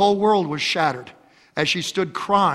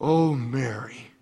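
tender Jesus; 5 semitones lower, 4 dB quieter, slower than usual. Acted. Jesus speaks Mary's name.

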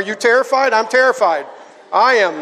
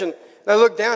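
ordinary talk

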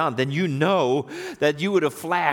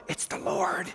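hushed, breathy realisation; awed whisper; 7 dB quieter, slower than usual. Acted. John is first to figure it out, awed whisper. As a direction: whisper it as it dawns on you.

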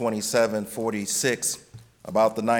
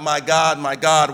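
mild cry; 4 dB louder than usual. Acted. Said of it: "But when Jesus screamed out".

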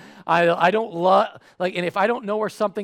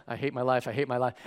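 low, quiet repeated grumble; mock self-pity under the breath; miserable inner voice; 6 semitones lower, 6 dB quieter, faster than usual. Customer inwardly suffering through the interaction, miserable.

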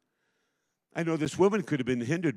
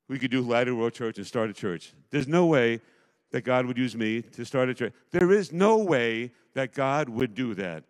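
ordinary talk, nothing marked

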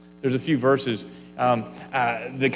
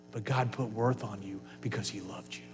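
hushed, unvoiced whisper; soft whispered reassurance, much quieter; 9 semitones lower, 12 dB quieter than usual. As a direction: breathe it as an intimate secret. Quiet aside within repeated line.

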